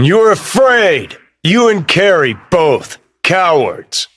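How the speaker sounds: high-pitched, drawn-out taunt; scornful accusation. Acted. Calling two companions cowards, scornful and heated.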